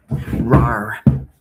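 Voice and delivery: a short mock roar, growled out loud; playful lion roar. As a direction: roar like a lion, half joking. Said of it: "Lion of Judah".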